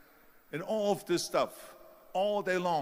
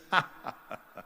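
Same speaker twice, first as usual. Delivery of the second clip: put-on dismissive cackle; imitated mocking laugh. Hollywood agent laughs at bodybuilder's movie ambition, imitated mocking laugh. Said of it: "He started laughing".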